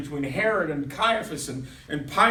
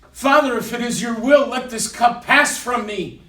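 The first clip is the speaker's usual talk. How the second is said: loud, higher-pitched plea to God; intense anguished prayer; 6 semitones higher, 9 dB louder than usual. Acted. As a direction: pray out loud with urgent intensity. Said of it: "the groaning that came from his voice was so intense".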